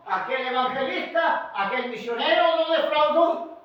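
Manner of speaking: high, loud pulpit cadence; forceful preaching. Real, not acted. Preacher naming those let down by believers, forceful preaching. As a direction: preach it out insistently.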